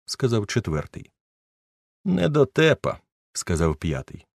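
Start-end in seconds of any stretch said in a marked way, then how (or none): 2.0-3.0 high, derisive jibe; mocking insult. Fifth friend mocking the narrator, high derisive jibe. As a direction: sneer it out mockingly.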